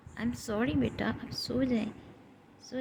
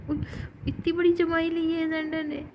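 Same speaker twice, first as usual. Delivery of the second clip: delighted exclaim; 7 semitones higher, 5 dB louder than usual. Real, not acted. Marvels at pet's big yawn.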